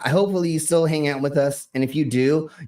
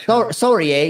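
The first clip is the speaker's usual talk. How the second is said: mock Canadian; 8 semitones higher, 5 dB louder than usual. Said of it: "You gotta say".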